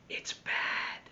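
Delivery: unvoiced whisper. Michael whispers the bad word to friends. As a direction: breathe it like a secret. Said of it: "he whispered".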